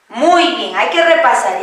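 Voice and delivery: ordinary talk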